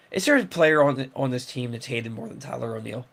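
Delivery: ordinary delivery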